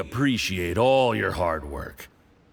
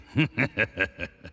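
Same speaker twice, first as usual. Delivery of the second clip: teasing laugh. Acted. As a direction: laugh at the friend who can't hold his drink. Teasing the lightweight drinker.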